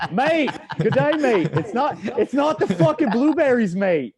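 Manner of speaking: high, loud hammed-up Australian accent. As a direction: slip into a cartoon Aussie, laughing. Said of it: "I turn, like, Australian".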